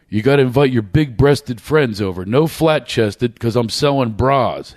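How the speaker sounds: normal talking voice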